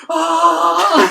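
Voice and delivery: high, loud panicked scream as bombs drop; mock scream. Doctors running from explosions, screaming. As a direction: yell like doctors fleeing explosions. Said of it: "they're just going".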